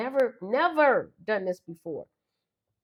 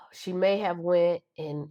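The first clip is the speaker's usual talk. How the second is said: ordinary talk, nothing marked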